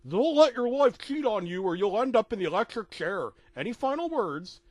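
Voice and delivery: Principal Mazur voice. Acted. Executioner warning Mickey before the chair, menacing. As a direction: lean in with a threat. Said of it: "Mazur looked at me ... and said".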